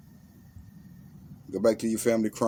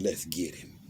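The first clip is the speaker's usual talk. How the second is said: hyenas plotting; 3 semitones lower, 6 dB quieter than usual. A narrator's reading. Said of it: "and they said".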